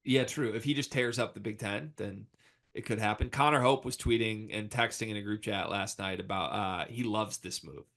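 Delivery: normal talking voice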